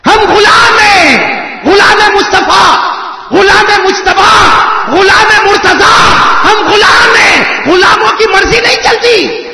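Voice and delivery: loud, high, slow preacher's cry; fiery preaching. Acted. Preacher declaring to the crowd, shouting slowly. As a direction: hammer each line to the crowd.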